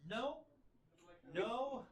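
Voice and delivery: high, quick no no; hasty denial. Acted. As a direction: wave it off in a hurry. Thastruck denies the fight.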